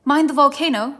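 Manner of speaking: loud, high warning shout; calling out. Acted. Queen warning the king across the room, loud call.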